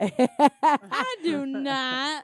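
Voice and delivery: a slow, loud protest through laughter; drawn-out laughing denial. Real, not acted. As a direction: deny it while cracking up.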